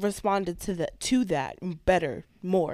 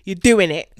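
much louder, forceful chant; mock American push; 13 dB louder than usual. Husband imitating his wife's American pushing, loud. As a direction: bark it like a pushy coach. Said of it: "She push me the American way".

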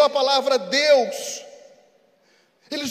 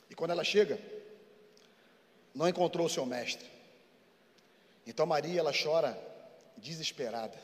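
narração suave; 7 semitones lower, 6 dB quieter than usual. Acted. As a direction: tell the tomb story gently, with long pauses. Soft story narration. (narração baixa e lenta)

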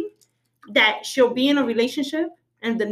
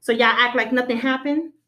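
normal talking voice

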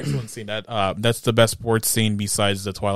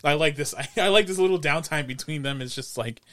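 ordinary delivery

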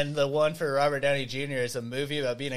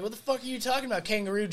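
higher and fast, imitating an outraged actor; mock indignant; 6 semitones higher, 4 dB quieter, faster than usual. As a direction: fire it off in fake outrage. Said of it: "he's like".

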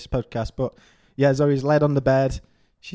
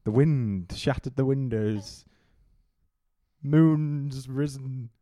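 dramatic storytelling; 2 semitones lower, much slower than usual. Acted. Mock dramatic story opening.

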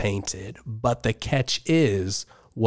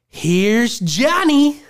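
very slow, high and louder; creepy Shining voice; 12 semitones higher, 4 dB louder, much slower than usual. Retold or imitated. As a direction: drag out Johnny like a madman. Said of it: "I'm gonna do my best to portray the voices".

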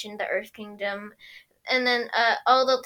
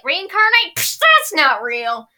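scoffing; 5 semitones higher, 8 dB louder, slower than usual. Retold or imitated. Sokka voiced scoffing.

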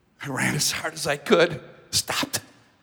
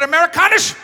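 put-on a light German accent on Amerikanisch, higher and louder; German cop; 12 semitones higher, 11 dB louder than usual. German officer checking whether the stranger is American, German accent. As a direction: ask it like a German police officer. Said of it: "yelling at me in German".

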